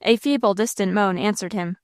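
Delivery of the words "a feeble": plain reading voice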